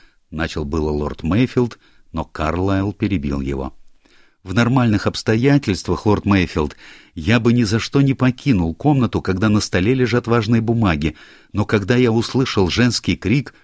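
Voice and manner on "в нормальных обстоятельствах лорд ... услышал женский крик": put-on higher, louder eager young man; a hint of an anxious young clerk; lightly voiced young secretary. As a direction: play the flustered secretary explaining himself.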